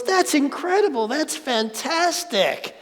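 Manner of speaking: mock-excited neighbor; gushing neighbor. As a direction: gush like the neighbor at good news. Neighbor voiced in Zen story.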